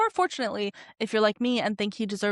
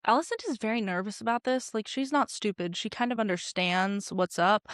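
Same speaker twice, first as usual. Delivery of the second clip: ordinary talk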